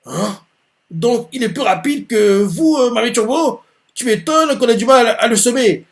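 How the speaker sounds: high, startled disbelief; shocked Takakura. Acted. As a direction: blurt it out, stunned. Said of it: "il fait".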